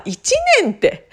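high and laughing; giggling at how absurd it is; laughing disbelief. Real, not acted. Laughing at the unbelievable answer.

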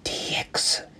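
soft unvoiced whisper. Real, not acted. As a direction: whisper the show's opening title. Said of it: "小声で喋る必要もないんですけれども". (ささやき声)

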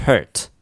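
"hurt" with the er vowel of work; isolated, careful "hurt"; hurt pronunciation model. Shown as a demonstration. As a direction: model "hurt" with mouth fairly closed. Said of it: "erっていうのを出すと".

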